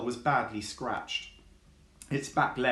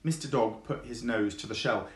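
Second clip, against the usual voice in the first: usual speaking voice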